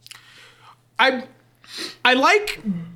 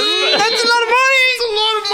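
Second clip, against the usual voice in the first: high greedy wail over money; greedy cry; 13 semitones higher, 3 dB louder, faster than usual. Acted. Greedy exclaim about money.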